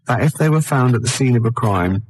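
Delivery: ordinary delivery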